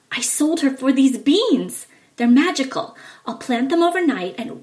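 proud of his magic beans; excited. Acted. Jack tells his mother about the beans, excited.

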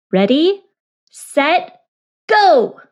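rising, loud countdown call; race starter shout. Acted.

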